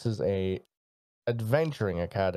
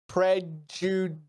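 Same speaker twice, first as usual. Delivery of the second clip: slow, drawn-out sarcastic word; a tired student mocking the professor, sarcastic; 8 semitones higher, much slower than usual. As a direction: say it with heavy sarcasm, slowly. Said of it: "Really sarcastically".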